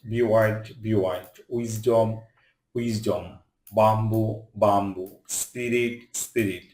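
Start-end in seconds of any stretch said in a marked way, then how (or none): none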